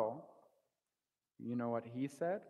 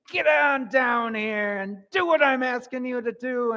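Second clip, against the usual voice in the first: loud, high retold shout; acted-out parent's yell; father calling out; 9 semitones higher, 13 dB louder than usual. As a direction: holler it up a hill to your boy. Father calling his boy down hill.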